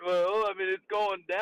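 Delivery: mocking buddy. Friend mocked, voiced buddy's excuse.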